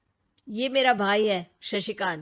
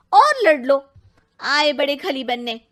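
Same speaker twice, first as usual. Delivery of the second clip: angry; 5 semitones higher, 8 dB louder than usual. Acted. TV scolds fighting brothers.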